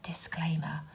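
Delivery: a soft unvoiced whisper; hushed aside; whispered disclaimer. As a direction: murmur it like fine print.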